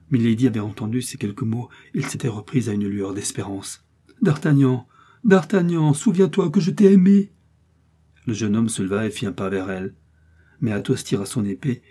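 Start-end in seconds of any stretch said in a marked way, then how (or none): 4.2-7.3 loud, high, pleading cry; desperate appeal. A narrator's reading. A condemned woman begging her former lover, desperate appeal. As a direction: call out, climbing in pitch with hope.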